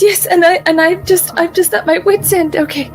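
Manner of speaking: put-on lost and frantic in a British accent; a put-on British accent, at her wits' end. To the party in the catacombs, a mock panicked British accent. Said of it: "Why am I British?".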